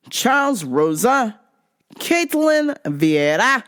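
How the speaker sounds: normal talking voice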